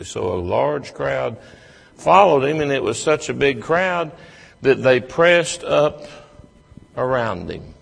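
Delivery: fervent preacher's build; preaching. Acted. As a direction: build it up like a preacher at full force. Preaching builds to full force.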